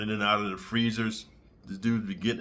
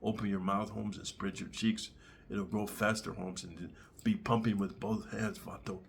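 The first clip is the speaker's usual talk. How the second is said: softer, half-whispered Chicano coaxing; 4 semitones lower, 10 dB quieter than usual. Retold or imitated. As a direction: coax him gently like a gang member. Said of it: "talking about".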